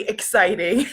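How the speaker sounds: breaking into a laugh; amused, laughter spilling out. Real, not acted. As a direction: let a chuckle take over at the end. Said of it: "I'm laughing 'cause my mom is in the comments".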